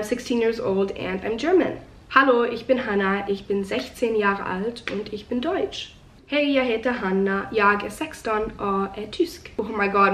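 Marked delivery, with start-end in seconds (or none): 2.1-5.9 self-introduction spoken in German; "Hallo, ich bin Hannah" in careful learner German; speaking German. Shown as a demonstration. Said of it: "asking if I could film myself speaking them". 6.3-9.5 hesitant Swedish with a weak accent; "Hej, jag heter Hanna" in shaky Swedish; speaking Swedish. Shown as a demonstration. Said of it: "my Swedish accent is so bad".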